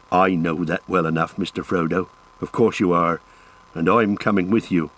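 low-pitched rustic servant, dogged; low, steadfast rustic voice; stubborn loyal Sam. A narrator's reading. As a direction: insist doggedly in a low country voice.

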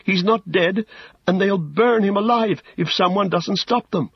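high, urgent plea; Pippin's alarmed cry. A narrator's reading. Pippin warns Gandalf that Faramir will burn, crying out. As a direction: plead in high-pitched alarm. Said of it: "cried Pippin".

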